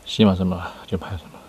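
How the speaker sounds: ordinary delivery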